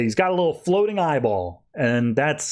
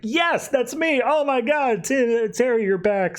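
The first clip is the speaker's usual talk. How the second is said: joyful reunion; 10 semitones higher, slower than usual. Retold or imitated. Happy family reunion.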